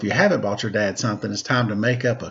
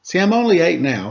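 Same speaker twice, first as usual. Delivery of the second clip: a hint of a kid pleading, a bit louder; lightly voiced kid; 7 semitones higher, 6 dB louder than usual. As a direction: brighter child's plea. Child pleading in story.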